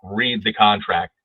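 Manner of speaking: "Read the contract" said slowly, with a firm falling stress on "Read"; slow emphasis. Shown as a demonstration. Instructor repeating to agents with slow, firm emphasis. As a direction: press each word, slowly and firmly. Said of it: "I can't emphasize this enough".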